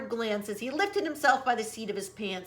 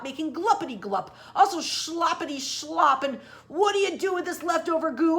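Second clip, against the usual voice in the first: louder, higher angry snapping; 3 semitones higher, 4 dB louder than usual. Acted. Lorax scolds the Wutzler about the goo, snapping louder. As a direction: snap at him, building to a shout. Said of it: "snapped the Lorax".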